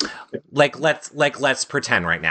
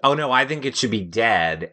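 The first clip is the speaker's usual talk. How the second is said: usual speaking voice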